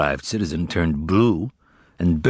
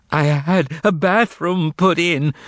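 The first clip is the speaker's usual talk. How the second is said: high, slow frightened cry; terrified; 9 semitones higher, slower than usual. A narrator's reading. Bloodied Mogarich excusing himself, terrified. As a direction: cry out in terror. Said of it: "his teeth chattering and terrified".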